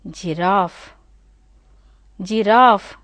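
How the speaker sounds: Giraffe with a long open A, like "jiraaf"; British long A. Shown as a demonstration. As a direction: stretch the A in Giraffe. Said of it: "See, long A is there".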